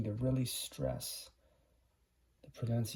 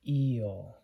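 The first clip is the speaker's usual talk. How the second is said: Eel with the ee leaned on, louder; stressed long E; 4 dB louder than usual. Shown as a demonstration. Teacher showing the long vowel, stressed Eel. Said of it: "Long sound".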